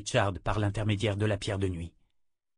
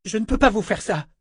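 high, loud, appalled protest; horrified refusal; 11 semitones higher, 5 dB louder than usual. A narrator's reading. Woman refusing to use her power on the wizard, horrified. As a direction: refuse in horror. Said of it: "s'exclama-t-elle horrifiée".

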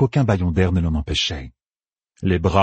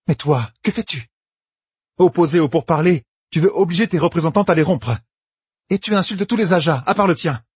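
raised-pitch appeal turning to accusation; earnest accuser; 8 semitones higher than usual. Acted. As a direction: plead the case, then point the blame. Egwene plaide puis accuse Elaïda. (plaidoyer qui devient accusateur)

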